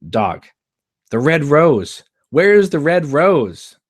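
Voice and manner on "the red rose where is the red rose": much higher and louder, slow on "Where is the red rose"; a magic trick gone wrong; frustrated. Acted.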